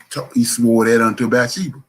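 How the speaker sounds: normal talking voice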